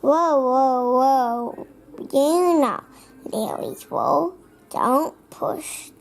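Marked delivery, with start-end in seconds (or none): none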